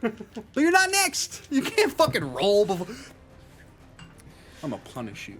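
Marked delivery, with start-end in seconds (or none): 0.0-2.9 loud, high playful scolding; telling off a player in jest; mock angry scolding. Real, not acted. GM scolding pre-rolling player.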